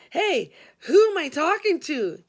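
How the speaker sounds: loud, high eager question. A narrator's reading. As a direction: press her eagerly. Eager caller.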